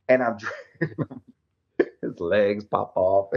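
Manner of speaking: breaking into a laugh mid-line; laughing through it. Real, not acted. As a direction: let the laugh slip in as you repeat it. Repeats it, laughing.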